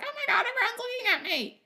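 high, fast mock panic; anxious inner voice. To herself in a shopping centre, high fast mock panic. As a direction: squeak it out like you're sure everyone's staring.

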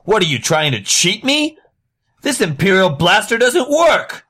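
high-pitched outraged haggling yell. Acted. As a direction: yell like a cheated customer making a public scene. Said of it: "start yelling".

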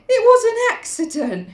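high-pitched insistent cry; wild protest. Acted. Piggy insisting on his excuse, wildly protesting.

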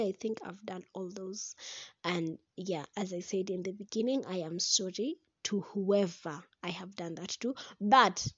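ordinary talk

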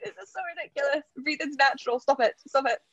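high, fast, half-laughing self-scolding; mock self-scolding. Scolding herself before the race, mock exasperated. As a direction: tell yourself off, half amused.

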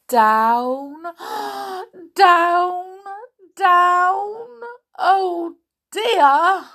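high, loud, drawn-out exclamations; dramatic alarm. A narrator's reading. Reader follows the falling boat swings, dramatic alarm. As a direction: call each word out as if plummeting.

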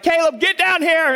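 high, loud call from the basement; wife calling up. Retold or imitated. Wife shouting up the stairs for Caleb, high and loud. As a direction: yell for him to come down, half alarmed. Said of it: "Amanda's like".

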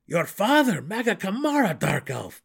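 high, loud disbelief; shocked protest. A narrator's reading. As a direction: protest in alarm. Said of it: "Belwer protested".

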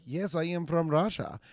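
put-on lightly done Russian accent; a hint of a Russian lady accent. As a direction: mimic an actress's Russian accent. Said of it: "Nicole Kidman's Russian".

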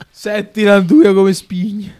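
slow, high Roman drawl; Roman accent. Retold or imitated. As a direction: repeat it like a Roman foodie. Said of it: "l'accento romano aiuta".

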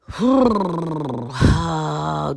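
a long, fed-up sigh; weary sigh. Acted. Hannah sighs at her husband, a long weary sigh. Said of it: "just sighing and rolling her eyes".

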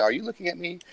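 quiet retelling of her question; quoting Suzanne. Retold or imitated. Retelling Suzanne before the demon emerges, softly quoting her. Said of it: "she's saying".

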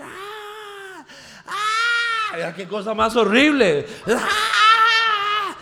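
high, loud screams of a possessed woman; screeching seer; retold screaming fortune teller. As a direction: shriek like the fortune teller in a fit. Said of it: "Empieza a gritar".

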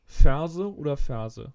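"Ferse oder Ferse" drawn out and loud for a bad line; slow it right down and call it out loud; repeating the quiz word loud and slow over a bad connection. Shown as a demonstration. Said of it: "Ich verstehe dich nicht".

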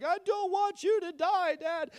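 high, little-girl voice, mock tearful; imitating a crying child. Pastor imitating his daughter crying at a movie, high mock sob. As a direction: play the sobbing daughter, pitched well up. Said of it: "Isla starts sobbing, and she's bawling".

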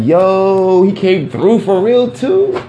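high, loud, slow disbelieving yo; imitated excited crowd. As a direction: act out folks amazed he came. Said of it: "It'd be like".

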